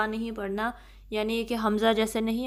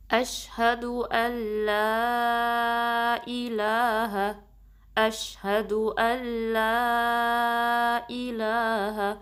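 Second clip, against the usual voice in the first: very slow, drawn-out recitation of the greeting; much slower than usual. Shown as a demonstration. Teacher reciting the phrase for students to repeat. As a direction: stretch each vowel in a measured chant. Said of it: "حمزہ کو شین سے ملانا ہے".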